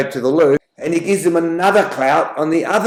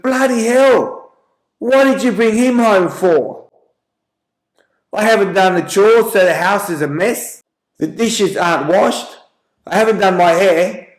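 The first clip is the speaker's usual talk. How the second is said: higher-pitched mock tirade at a husband; pissed-off wife; 5 semitones higher than usual. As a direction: play a shocked, annoyed wife telling off her husband. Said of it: "amazed and she's shocked and she's also pretty pissed off".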